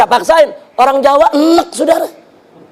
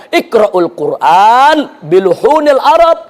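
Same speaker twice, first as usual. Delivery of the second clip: slow, measured hadith recitation; stately quotation of sacred Arabic; 3 semitones lower, slower than usual. Shown as a demonstration. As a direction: recite the Arabic slowly with drawn-out vowels.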